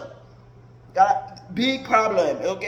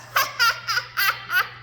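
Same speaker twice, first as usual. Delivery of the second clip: high mocking cackle; high-pitched mock laugh; 13 semitones higher than usual.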